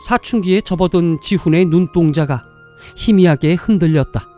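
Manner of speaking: usual speaking voice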